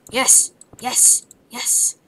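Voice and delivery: loud, triumphant cheers; excited yell. Real, not acted. Gamer cheering at a lucky drop, loud excited yes. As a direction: shout it out like you just won.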